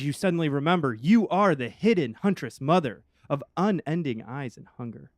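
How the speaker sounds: rising and falling, emphatic reveal; dramatic game narration. Acted. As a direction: build it up grandly, punching key words. Game master reveals a compulsion.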